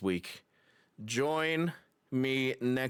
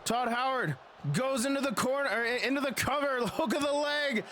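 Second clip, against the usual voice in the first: higher, rising hype call; excited wrestling commentator; 14 semitones higher than usual. Acted. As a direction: build the hype for the finish. Title about to change hands.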